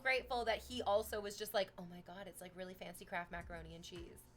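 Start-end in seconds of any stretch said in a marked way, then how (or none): 1.8-4.2 much softer, confiding; mock whisper, still voiced; hushed retelling. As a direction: murmur it like a secret at dinner. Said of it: "he whispers really quietly".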